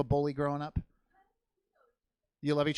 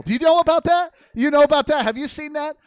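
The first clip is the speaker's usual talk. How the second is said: eager; 10 semitones higher, 11 dB louder than usual. Real, not acted. Eager question burst.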